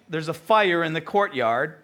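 ordinary talk, nothing marked

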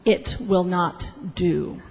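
measured, final refusal; disapproving official. Acted. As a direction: turn it down slowly and quietly. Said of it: "And then she said".

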